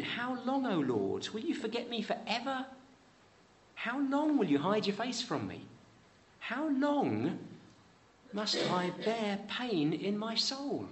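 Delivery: high, aching plea, slowing on the last line; psalmist's lament. Acted. Preacher reading Psalm thirteen to the congregation, high aching lament. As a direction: voice the psalmist begging God for an answer. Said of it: "Psalm thirteen".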